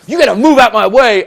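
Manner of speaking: very loud, high mock threat; aggressive. Some guy threatening my friends in a gang, aggressive. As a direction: imitate a tough guy shouting a challenge. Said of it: "very aggressive guys come up to my friends".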